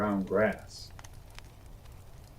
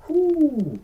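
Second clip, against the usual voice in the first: relieved whoo; 6 dB louder than usual. Acted. Relief after close call.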